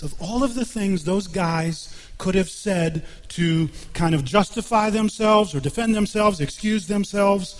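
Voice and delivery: fervent preaching. Acted. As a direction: build the case with rising fervor. Preacher builds to fervent pitch.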